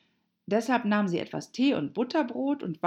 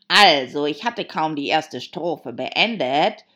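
loud, drawn-out Hatter voice; emphatic, slow storyteller; Mad Hatter; 10 dB louder than usual. A narrator's reading. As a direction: tell it loudly and slowly.

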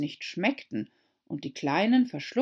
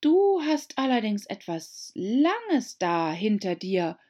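put-on high, eager young girl voice; pleading girl reminding the mouse; Alice's voice; 4 semitones higher, 5 dB louder, slower than usual. A narrator's reading. Alice to the mouse, high eager girl.

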